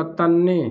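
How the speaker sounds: तन्ने with a doubled, held n; तन्ने with a long nn; geminated tanne. Shown as a demonstration. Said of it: "तूने अगर कहा".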